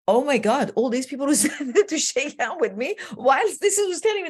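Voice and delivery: high, incredulous rush with a laugh; giddy, amazed exclamation, acted out; retold amazement. Retells her amazement after ovation.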